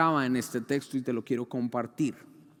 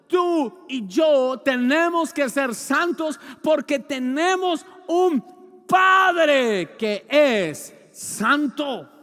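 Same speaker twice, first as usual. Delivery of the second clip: slow, loud, shouting peak on "yo"; shouted climax; 10 semitones higher, 9 dB louder, much slower than usual. Acted. Preacher shouting "santos" and "Padre" at the congregation. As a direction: shout "Tú y yo" slowly at full force. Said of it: "repeated with a shout".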